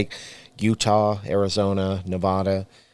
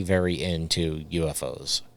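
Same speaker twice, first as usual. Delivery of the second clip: ordinary delivery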